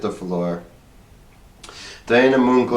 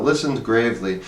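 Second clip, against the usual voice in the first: ordinary delivery, nothing marked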